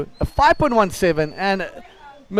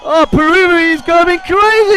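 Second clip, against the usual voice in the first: loud, high burst of excitement; excited shout; 8 semitones higher, 6 dB louder than usual. Real, not acted. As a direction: erupt as the wave lands. Commentator erupts at big wave.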